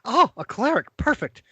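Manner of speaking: put-on an old wizard greeting the party at the door; Corbin, eager wizard. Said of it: "He quickly turns around to Barry".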